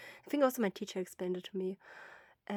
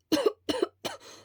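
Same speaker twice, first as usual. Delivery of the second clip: cough; 6 dB louder than usual. Acted. Throat burned, coughing.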